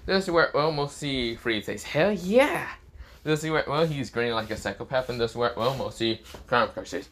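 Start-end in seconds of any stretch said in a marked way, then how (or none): 1.8-2.7 high, drawn-up whoop of agreement; acted excited hell-yeah; gleeful cheer. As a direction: cheer like you're eager for the hunt. Freed cheers the devil hunt.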